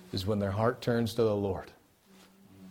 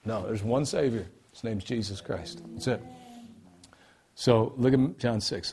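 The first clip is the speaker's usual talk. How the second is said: ordinary talk, nothing marked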